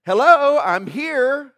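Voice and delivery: high, loud call for attention as Jesus; Jesus calling out to the city, loud and high. Retold or imitated. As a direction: shout it out high and loud to get noticed. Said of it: "He's really saying this".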